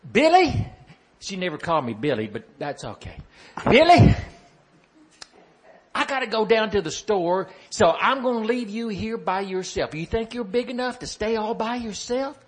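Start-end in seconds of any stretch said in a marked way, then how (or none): 0.1-0.7 high, loud motherly call of Billy; mama calling out. Retold or imitated. 3.6-12.4 mama voice. Retold or imitated. Mama speaking to little Billy.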